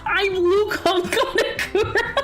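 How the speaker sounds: high cartoon-villain accent breaking into laughter; Gru. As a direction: attempt the villain accent while cracking up. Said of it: "I can't keep that voice up".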